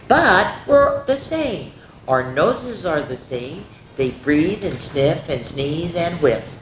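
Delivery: ordinary delivery, nothing marked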